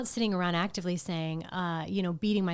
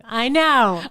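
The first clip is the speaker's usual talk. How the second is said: normal talking voice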